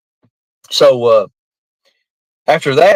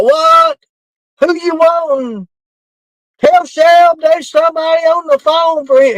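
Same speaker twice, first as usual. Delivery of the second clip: hollering; 13 semitones higher than usual. Retold or imitated. Joe on the steps shouting to the holler that someone's on the phone, high holler. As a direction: yell it from the porch steps.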